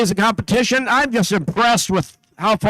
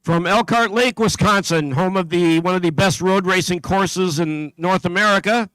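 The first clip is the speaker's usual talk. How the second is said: steady, carrying PA announcer voice; announcer read; slower than usual. Acted. Announcer calling out teams to the competition crowd, steady and carrying. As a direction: call out each town and team number to the hall.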